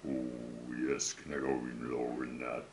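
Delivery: usual speaking voice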